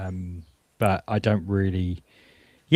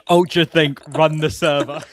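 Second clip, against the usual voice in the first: higher, louder mock hype; playful hype; 5 semitones higher, 6 dB louder than usual. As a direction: play up the catchphrase. Echoing the joke, laughing.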